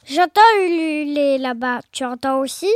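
low monster voice; voix du Gruffalo. Acted. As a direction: ask it deep as the big beast. Gruffalo speaks in the story. (monstre à voix grave, intrigué)

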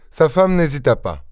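ordinary delivery

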